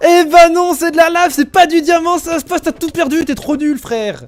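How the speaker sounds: high, loud mocking gloat. Real, not acted. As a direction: gloat over his loss. Gloating, player hit lava. (moquerie triomphante, aiguë et forte)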